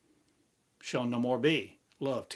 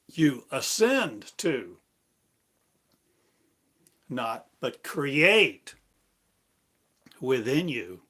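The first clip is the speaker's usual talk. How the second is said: slow emphatic preaching; 2 semitones higher, 6 dB louder, slower than usual. Acted. Slow emphatic sermon lines.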